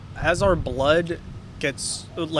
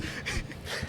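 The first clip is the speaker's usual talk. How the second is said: breathy laugh; 11 dB quieter than usual. Real, not acted. Laughing after the whisper.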